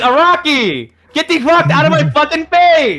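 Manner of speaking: very high, loud angry outburst at the author; furious retold yell. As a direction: scream at him to get lost. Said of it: "I was so mad".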